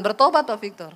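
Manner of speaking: usual speaking voice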